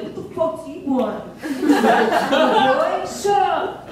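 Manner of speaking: brash hard-ass act; mock tough ex-con talk. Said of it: "like, a real, like, hard ass".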